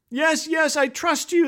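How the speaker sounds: high, eager mock holler at normal volume. Climber to the majestic voice, high mock holler. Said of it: "the man hollers".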